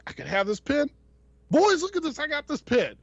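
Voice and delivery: high, loud Brooklyn wise-guy delight; excited artist voice. Retold or imitated. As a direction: call the boys over, thrilled. Artist thrilled with pen.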